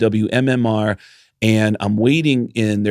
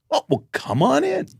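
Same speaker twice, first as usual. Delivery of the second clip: lightly voiced older man, much higher and welcoming; old radio man. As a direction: play the legend inviting him in. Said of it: "He goes".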